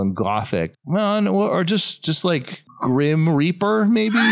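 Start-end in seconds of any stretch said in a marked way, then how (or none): 2.8-4.2 excited. Real, not acted. Mock naming, pitch leaps up.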